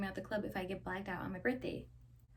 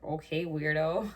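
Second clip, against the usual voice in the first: lower, slow sarcastic brush-off; slow, deep, unimpressed eye-roll; dismissive; 5 semitones lower, 6 dB louder, slower than usual. Retold or imitated. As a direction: write him off with a sneer. Brushing off mad date.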